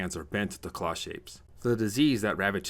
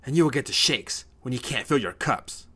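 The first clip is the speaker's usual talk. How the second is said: loud, raised retort; angry yell; 6 semitones higher, 6 dB louder than usual. A narrator's reading. Ryan stands up to drunken father, yelling. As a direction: yell back at your father. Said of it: "Ryan yells".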